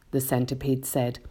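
ordinary delivery

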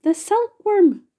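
high, loud, drawn-out exclamation; triumphant cry. A narrator's reading. Grasshopper revealing the answer to James, loud high cry. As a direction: announce it loudly, high and drawn out. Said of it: "cried the old green grasshopper".

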